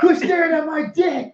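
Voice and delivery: high yelled movie line; movie quote shout. Retold or imitated. Quoting the movie scene to his podcast host, high mock shout. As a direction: yell it like the angry guy in the film. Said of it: "You hear that part".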